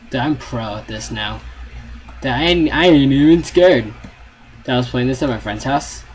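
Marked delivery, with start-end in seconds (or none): 2.2-3.9 high, loud bravado; loud bragging. Real, not acted. Gamer insisting to viewers he ain't scared, loud bravado. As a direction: boast it at full volume. Bragging loudly while playing.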